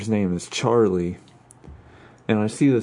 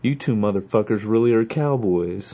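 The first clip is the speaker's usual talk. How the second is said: normal talking voice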